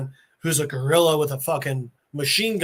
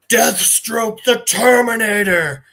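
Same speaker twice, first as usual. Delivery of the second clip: loud, slow, grand supervillain proclamation; hammed-up comic-book villain; villain's dramatic reveal; 3 semitones higher, 5 dB louder, slower than usual. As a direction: boom out the name, drawing it out.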